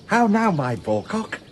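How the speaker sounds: high sing-song coaxing; mock tender baby voice; coaxing baby talk. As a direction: coo at him like a pet. Coaxing Malvolio like a child.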